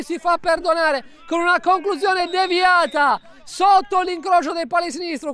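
high, loud, drawn-out goal cry; breathless italian goal commentary. Real, not acted. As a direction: stretch the shout out in joy. Equaliser scored right after blunder.

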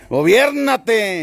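slow, coaxing ¡Gobiérnate!; very slow soothing order to the animal; drawn-out command. Acted. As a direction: stretch it out very slowly. Calming the animal again.

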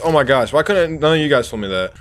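ordinary delivery

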